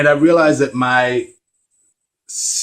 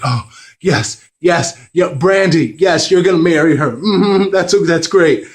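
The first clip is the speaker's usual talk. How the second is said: higher, excited mock mom; imitating mom; 7 semitones higher, 4 dB louder than usual. A son imitating his mom gushing about his [name] crush, high and eager. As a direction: gush approvingly like an eager mother. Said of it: "She's like".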